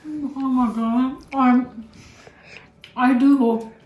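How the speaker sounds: talking with a mouthful; words round a bite of food; mouth full. Real, not acted. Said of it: "don't talk with the mouth full".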